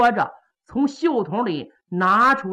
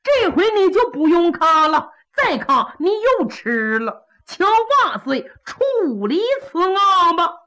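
mocking refusal; 8 semitones higher, 4 dB louder than usual. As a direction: tease him, then address the emperor. Kou Zhun refuses mockingly.

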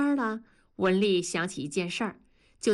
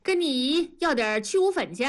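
put-on raised, higher-pitched call to a child; 6 semitones higher than usual. A narrator's reading. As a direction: call out an errand to the kid. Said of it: "就冲着多多喊".

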